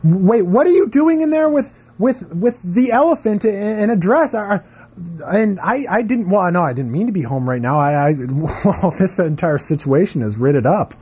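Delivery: high, stammering acted surprise; flustered character. Spouse walking in at home unexpectedly, flustered. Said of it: "three sentences that they imagine, uh, the verb writ would be used in".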